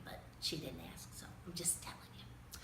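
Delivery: unvoiced aside to the children, half hidden; whispered aside. Reader leaning in to the kids, whispering an aside. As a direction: whisper it as a secret.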